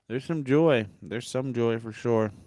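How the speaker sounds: subdued, unconvinced delivery; joyless. Real, not acted. Podcaster half-heartedly admitting hope for the Phillies, joyless. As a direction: say it with little enthusiasm. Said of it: "You sound very joyous right now".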